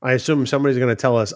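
ordinary talk

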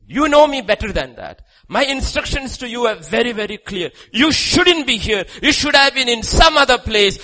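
high, loud scolding at preaching pitch; forceful rebuke. Acted. God to Elijah who ran in fear, forceful rebuke. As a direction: rebuke him hard, then order him back.

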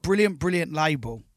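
ordinary talk, nothing marked

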